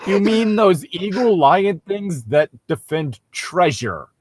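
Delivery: ordinary delivery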